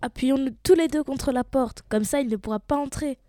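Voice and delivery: high, loud and urgent; pressing call to hold out against the wolf; urgent little pig. Acted. Pigs barricade the door against wolf.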